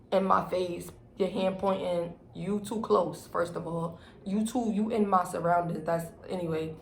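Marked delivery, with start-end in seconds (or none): none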